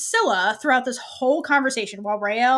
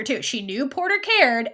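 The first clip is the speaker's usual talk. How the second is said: ordinary talk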